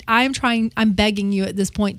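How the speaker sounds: usual speaking voice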